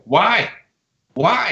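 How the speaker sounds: high pleading why; protesting shout; retold scream. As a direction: cry out why in disbelief. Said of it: "I'm starting to scream and fight these dudes".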